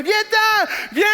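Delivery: high, loud come here; calling out. Pretend. Villager calling the survivor over, shouting. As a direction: wave him over by voice. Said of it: "il ferait".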